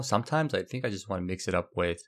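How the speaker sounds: ordinary talk, nothing marked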